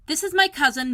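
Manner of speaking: high, loud princely announcement; announcing. A narrator's reading. Colin presenting Mary to the doctor, announcing grandly. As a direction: proclaim it like a prince. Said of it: "Colin announced".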